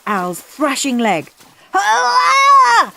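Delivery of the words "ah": high loud startled scream. Acted. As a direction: yelp in sudden fright. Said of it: "screamed Al".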